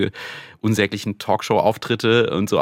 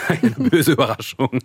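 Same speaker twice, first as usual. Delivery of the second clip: laughing through the words; 5 semitones higher than usual. Real, not acted. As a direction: chuckle while saying it. Laughs about hair falling out.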